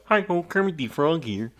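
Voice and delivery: cartoon frog greeting; Kermit the Frog. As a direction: chirp it like a frog puppet host. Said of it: "impression of Kermit the Frog".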